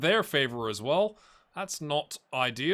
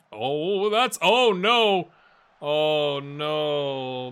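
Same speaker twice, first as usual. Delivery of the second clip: dismayed oh no; 6 dB louder, much slower than usual. Real, not acted. Dismay at injury.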